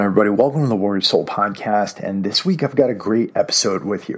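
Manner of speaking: ordinary delivery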